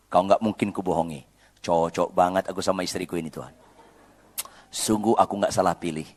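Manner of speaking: husband praying about an angry wife; mock prayer. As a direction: tease husbands with a humble prayer. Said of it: "bilang sama Tuhan".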